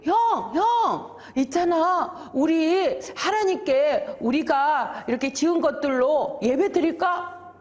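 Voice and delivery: eager younger brother. Acted. Abel asking his older brother to worship together, lightly acted eager pleading. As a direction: pipe up with a hopeful suggestion.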